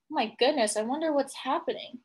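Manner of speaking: normal talking voice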